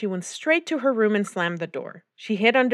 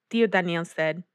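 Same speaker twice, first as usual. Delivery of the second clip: ordinary delivery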